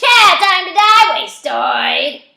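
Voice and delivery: high, very loud drawn-out scream; gleeful scream. A narrator's reading. Rat jeering at Zach in his head, screaming. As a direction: scream it with wild relish. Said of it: "Rat laughs and screams".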